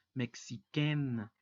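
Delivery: "mexiquén" stretched slowly, ending in "-quène" with no nasal; teacher modelling the feminine form slowly; slow French demo. Shown as a demonstration. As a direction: draw out "mexiquén" at half speed. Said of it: "la pronunciación es mexiquén".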